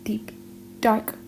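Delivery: usual speaking voice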